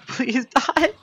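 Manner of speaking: high pleading shout; desperate plea. Real, not acted. Gamer fighting an enemy, pleading high and desperate. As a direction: beg the monster to die.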